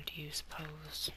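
breathy whispered question. Real, not acted. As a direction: whisper it to yourself. Whispered aside.

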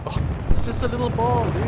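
loud, high-pitched disbelief; high, loud yelp of words; startled exclamation. Real, not acted. Startled by the next trap.

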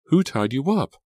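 high, loud questioning; urgent demand. A narrator's reading. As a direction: press him for an answer. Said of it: "she demanded".

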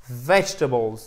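vegetables as VEDGE-tuh-bulz; silent middle e. Shown as a demonstration. Teacher models the word for learners, vegetables stressed on VEDGE. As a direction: punch the first syllable of vegetables, skip the second e. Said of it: "le E ici se prononce pas".

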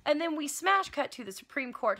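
usual speaking voice

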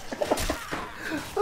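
high airy burst of laughter; breathless laugh. Real, not acted. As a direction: laugh without voice. After jumping into axe.

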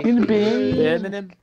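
slow, drawn-out, high and loud mock Brummie; Brummie accent. Mates mocking Birmingham, a hammed-up slow Brummie.

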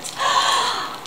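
sharp high breathy gasp; excited gasp. Real, not acted. Vlogger gasping at her new parcel, excited gasp. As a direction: gasp in delight.

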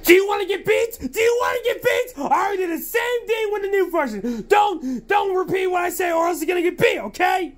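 high, fast angry yelling; angry shouting. Acted. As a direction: yell a threat at the cat. Scolding the cat app.

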